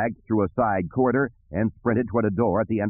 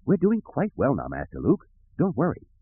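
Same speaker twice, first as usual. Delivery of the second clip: prim droid voice, high then dropping; reassuring droid; 6 semitones higher than usual. Acted. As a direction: reassure the master fussily.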